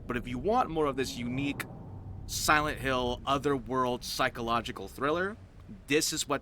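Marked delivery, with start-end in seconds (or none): none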